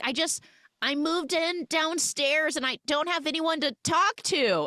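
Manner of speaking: high, pleading mock kid voice; imitated girl character. Girl explaining she is new downstairs, imitated in a high plea. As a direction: explain yourself up high, slowing at the end. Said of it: "She's like".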